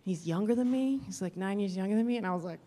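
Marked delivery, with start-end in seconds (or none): none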